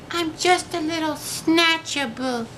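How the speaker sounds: very high, small, sad creature voice; mournful mumble. A narrator's reading. Snatch-a-Book admitting to Eliza, high mournful mumble. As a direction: shyly own up. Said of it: "He mumbled with a mournful look".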